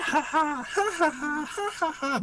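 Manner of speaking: high, cackling illusion laugh; creepy laughter. Retold or imitated. Mouse hero's illusion laughing to scare the guys. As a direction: cackle high and eerie down the hall. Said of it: "uses minor illusion to".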